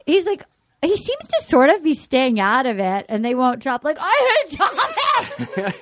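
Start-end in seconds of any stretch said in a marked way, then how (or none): 4.0-5.7 high, stretched-out comic whine, laughing; hammed-up hater imitation; mock hatred. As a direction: draw it out high as a laughing fake tantrum.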